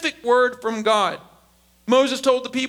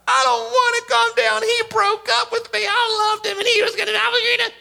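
put-on high-pitched wailing heartbroken kid; mock tearful meltdown, pitched way up; riled-up teen; 11 semitones higher than usual. Riled-up kid crying to parent over breakup, hammed-up wail. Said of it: "they're loud, and they're all riled up".

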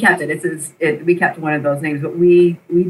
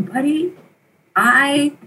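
put-on tender greeting to baby; 6 semitones higher, slower than usual. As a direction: gently welcome the baby home. Mother first meeting adopted baby.

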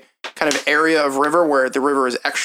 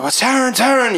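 high, raised cries of the name; excited townsfolk; 10 semitones higher than usual. Acted. Townspeople hear it over and over, crying out. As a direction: pass the name along as a cry.

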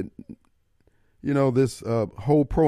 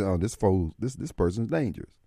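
lower, softer muttered thought; quiet aside; 9 semitones lower, 9 dB quieter than usual. Retold or imitated. Host thinking about a dangerous fool, quiet and low. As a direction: say it low to yourself, wary.

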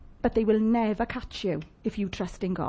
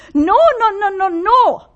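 loud laughing no, no; laughing protest; 9 semitones higher, 9 dB louder than usual. Real, not acted. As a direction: laugh it off loudly. Laughing denial, loud and high.

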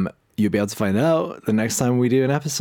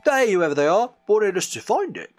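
Sonic voice; 5 semitones higher, 4 dB louder than usual. Acted. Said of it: "says Sonic".

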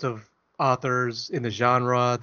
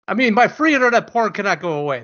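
louder, higher hammed-up complaint; mock outrage; 7 semitones higher, 5 dB louder than usual. Joking to his cohost about free porn, hammed-up outrage. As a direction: fake indignation for laughs.

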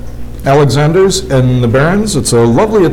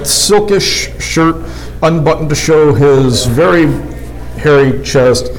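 normal talking voice

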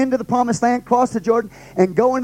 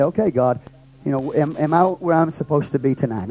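quiet prayer; 7 semitones lower, 3 dB quieter, faster than usual. Pretend. Preacher voicing his own prayer to God, quiet and searching. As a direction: lower and softer, a quiet self-searching prayer.

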